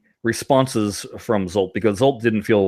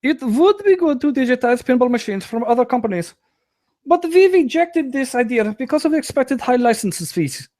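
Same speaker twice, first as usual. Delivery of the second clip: put-on much higher, overdone German accent; terrible German accent; 12 semitones higher than usual. Host voicing the developer's answers in a mock German accent. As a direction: ham up a bad German accent reading the answers. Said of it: "poor impersonations of a German person".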